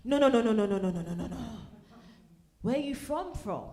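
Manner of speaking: insistent no-no-no then pointed question; mock the pushy stranger; imitating the insistent asker. Comic mimicking a man who presses where she's from, insistent. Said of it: "and I go".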